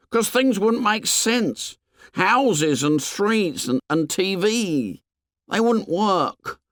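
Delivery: put-on high, slow, insistent boy; high slow Piggy. A narrator's reading. Piggy reassures Ralph, high and slow.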